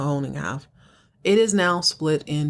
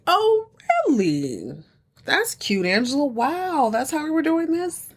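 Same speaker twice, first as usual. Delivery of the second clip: mock-impressed attitude; 8 semitones higher than usual. Nephew learns who is buying the third, sarcastic. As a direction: stretch the first words, then stay high and pointed. Nephew reacts to aunt's news.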